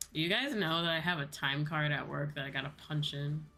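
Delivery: ordinary talk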